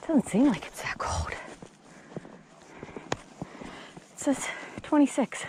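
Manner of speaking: incredulous whisper. Real, not acted. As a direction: whisper it in disbelief. Surprised by cold temperature reading.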